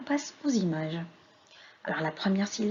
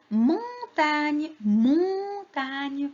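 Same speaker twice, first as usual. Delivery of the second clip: "montagne" slow and higher, "mon" held; sounding out; 5 semitones higher, much slower than usual. Shown as a demonstration. Teacher answers what the drawing shows, sounding it out. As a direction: stretch "montagne" in two long syllables. Teacher repeats word syllable by syllable.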